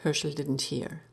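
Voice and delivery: ordinary delivery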